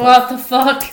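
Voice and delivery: loud startled swear; shocked shout. Real, not acted. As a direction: yell in disbelief. Recording failure discovered.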